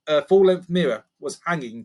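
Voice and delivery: ordinary delivery, nothing marked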